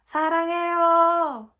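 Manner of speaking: loud high fan scream; Korean: 무대를 향해 외치는 사랑 고백; screaming love. Retold or imitated. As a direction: yell it to the stage. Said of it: "screamed at the top of my lungs".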